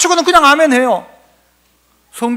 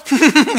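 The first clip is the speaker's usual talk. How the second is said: imitated snicker, trying hard not to laugh in church; suppressed snorting chuckle; stifled laugh; 3 semitones higher than usual. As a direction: laugh held in through clenched teeth. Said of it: "어떻게 웃나 하면서 이를 꽉 깨물고".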